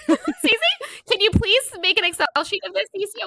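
high, loud mock summons to Cece; playful calling out. Host jokingly begging Cece to keep a list, high mock plea.

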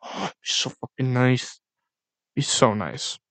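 soft, dreamy wish after a gasp; hushed longing. Real, not acted. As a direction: savor it softly, slowing to a murmur. Wistfully imagining an early release.

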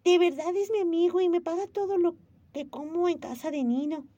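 put-on high, bright little girl voice; earnest young girl insisting; a child defends her friend; child Momo.

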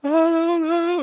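very high, small mock-innocent answer; squeaky cartoon I-don't-know; mock suspect voice. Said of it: "She goes".

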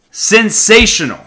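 loud, high burst on the word; yelled. Real, not acted. Yelling to podcast listeners, excited shout. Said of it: "Sorry I yelled".

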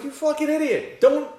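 high, loud frantic outburst; exasperated yell. Retold or imitated. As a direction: blurt out in panicked disbelief. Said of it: "I'm like".

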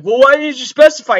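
loud, high mock outburst of an annoyed father; hammed-up retort from dad; imitating angry dad. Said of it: "He's like".

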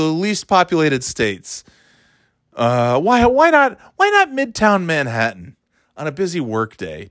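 high, slow sarcastic reporter voice; mock reporter. Comic mocking a reporter badgering Alec, high and slow. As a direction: pile on absurd questions in a whiny voice.

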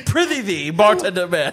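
mock olde English, grand and loud; hammy stage actor. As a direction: ham it up like a Shakespeare player. Mock Shakespearean greeting.

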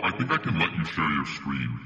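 ordinary talk, nothing marked

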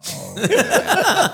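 high, loud hammed-up wail; exaggerated mock sob; mock crying. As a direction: imitate a crying recruit. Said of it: "he's crying and he's going".

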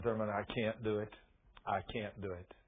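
low, quiet admission of defeat; dejected refusal. Retold or imitated. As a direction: retell it subdued and reluctant. Friend backs out, troubled.

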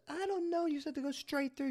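mock your younger self, flustered and high; high-pitched retold protest; panicked reply. Retold argument, his own reply.

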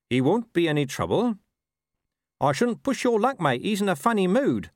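lightly voiced figures, rising high for the dog; rider, then his talking dog.

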